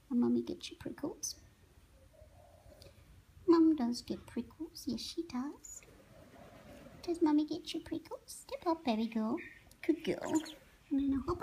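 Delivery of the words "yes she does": soft whispered aside; whispering to the parrot. Real, not acted. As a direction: breathe it to the bird.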